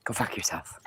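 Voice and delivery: a sudden unvoiced whisper under the breath; whispered insult. Real, not acted. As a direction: hiss it quietly after the rant. Muttered insult to the animal.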